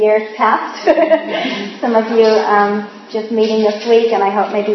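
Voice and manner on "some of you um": usual speaking voice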